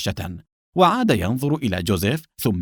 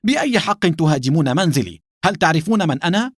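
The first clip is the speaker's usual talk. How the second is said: higher-pitched outraged challenge; affronted outburst, pitched up; indignant shout; 6 semitones higher than usual. A narrator's reading. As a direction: cry out and demand answers. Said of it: "على حين صاح جوزيف".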